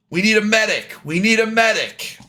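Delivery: high, urgent call for a medic; bandit yelling for help. Acted. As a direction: yell it out as an alarmed henchman. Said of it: "you heard red shirt guy yell".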